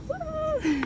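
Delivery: high excited whoop; excited cheer. Real, not acted. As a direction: cheer it, thrilled. Celebrating finishing week five.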